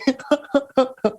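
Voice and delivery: high, bubbling giggle; a high-pitched run of chuckles; laugh. Real, not acted. Storyteller cued to tell a crazy story, high giggling laugh. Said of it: "with that laugh".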